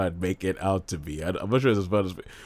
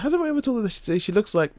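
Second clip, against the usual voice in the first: very high, wondering imitation; exaggeratedly high-pitched asker; mock curious question; 12 semitones higher, faster than usual. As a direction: squeak out an innocent question. Repeats the acted curious question.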